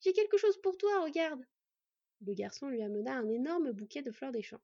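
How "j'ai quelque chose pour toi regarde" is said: eager, high and loud; excited exclamation. A narrator's reading. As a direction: show off a surprise for a friend. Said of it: "s'exclama-t-il". (annonce enjouée, haute et forte)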